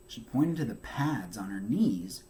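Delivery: ordinary delivery, nothing marked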